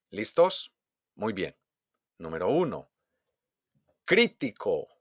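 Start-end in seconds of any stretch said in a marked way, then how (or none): 4.0-4.9 "Crítico" punched on CRÍ; exaggerated first-syllable stress. Shown as a demonstration. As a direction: hit CRÍ hard in "Crítico". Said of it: "exagerar la pronunciación". ("Crítico" con golpe de voz en CRÍ)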